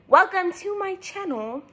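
high, overly proper channel intro; mock a polished vlogger welcome; prim youtuber voice. Mocking a proper youtuber to her viewers, prim and high. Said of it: "But I'm not gonna be like".

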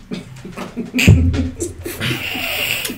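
airless laugh; breathy laugh. Real, not acted. Teller laughing as the story gets messy, airless laugh. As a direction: laugh under your breath.

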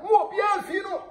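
high, shouted call to everyone; a sudden high shout at the viewers. Real, not acted. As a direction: yell it out to the crowd. Calling out to the audience.

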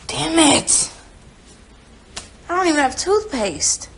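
a real burst of annoyance; frustrated outburst. Real, not acted. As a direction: spit out the curse in frustration. Realises there's no toothpaste.

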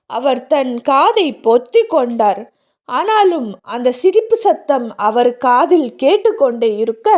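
ordinary delivery

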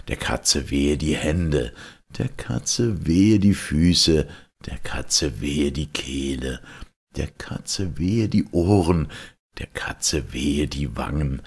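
ordinary delivery

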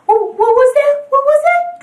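rising high and loud; startled, asking the air; surprised. Acted. Hears a bird.

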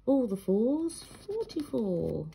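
sing-song bingo calls; bingo caller. Retold or imitated. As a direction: call the numbers like a bingo hall. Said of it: "I used to take turns calling the bingo numbers".

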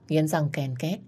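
plain reading voice

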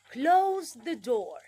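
slow, loud "Close the door"; drawn out for the class; over-articulated command; exaggerated command. Shown as a demonstration. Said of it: "lo exagerada que soy".

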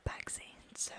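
normal talking voice

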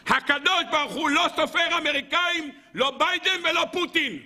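very high, insistent proclamation; impassioned preaching. Real, not acted. Rabbi telling listeners whom God doesn't count, proclaiming high. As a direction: call it out with pitch held high.

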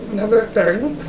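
talking through a mouthful of food; words muffled round a bite; mouth full. Real, not acted. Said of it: "Sorry, I'm eating with my mouth full".

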